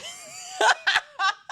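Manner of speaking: high burst of real laughter; helpless laughter. Real, not acted. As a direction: crack up at the joke. Laughs at the book title joke.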